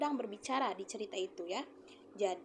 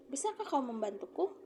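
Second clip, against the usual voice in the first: rising question tune on Bisakah kau membantuku; pleading question, voice lifting at membantuku; asking tone; 3 semitones higher than usual. Shown as a demonstration. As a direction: really ask it. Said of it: "seperti kamu akan bertanya".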